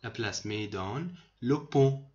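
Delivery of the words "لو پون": لو پون said louder, ending without any t sound; silent final t. Shown as a demonstration. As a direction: say لو پون with no t at the end. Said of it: "ته آخر خونده نمیشه". (لو پون را بلندتر و بدون صدای ت آخر بگو)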